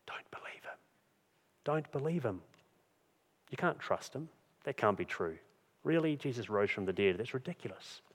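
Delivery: hushed, insinuating whisper of doubt; soft, sly tempter's murmur; Satan whispering. Acted. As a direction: whisper doubts into the listener's ear. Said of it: "Satan keeps whispering to us".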